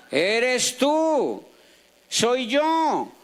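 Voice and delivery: "tú" and "yo" held long, then falling; emphatic stretched words. Real, not acted. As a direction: land hard on "tú" and "yo", stretching each. Repeating the point about responsibility.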